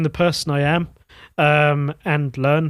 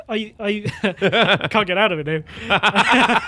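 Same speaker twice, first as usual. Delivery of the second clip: laughing; 5 semitones higher, slower than usual. Real, not acted. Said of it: "Does the accent get stronger".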